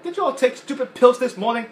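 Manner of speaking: high, loud scolding toy voice; Mister Potato Head impression. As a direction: berate the other toys. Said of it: "And he's like".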